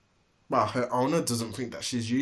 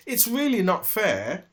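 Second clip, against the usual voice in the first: high, louder wail of unfairness; wailing in despair; 6 semitones higher, 6 dB louder than usual. A narrator's reading. Donkey Dilly wails to herself, high despairing complaint. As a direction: cry out that it isn't fair. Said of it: "she wailed in despair".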